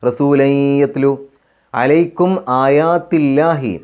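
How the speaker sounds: slow, drawn-out chanted recitation; measured Quranic chant. Shown as a demonstration. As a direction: recite it slowly with tajweed.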